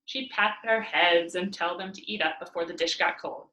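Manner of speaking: ordinary delivery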